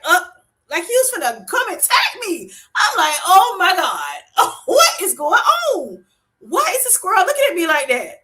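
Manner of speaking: high, loud panicked squeal; loud comic terror with laughter; scared. Retold or imitated. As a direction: freak out at a staring squirrel. Retold scary squirrel encounter.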